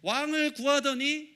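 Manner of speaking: loud, emphatic sermon delivery; forceful preaching. Acted. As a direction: drive each verdict home to the congregation. (힘주어 외치는 설교)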